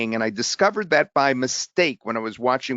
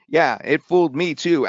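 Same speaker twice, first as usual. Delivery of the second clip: ordinary talk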